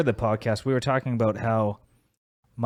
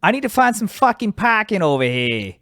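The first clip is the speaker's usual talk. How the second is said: Boston accent; 10 semitones higher, 3 dB louder than usual. Shown as a demonstration. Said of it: "That was actually a bad one".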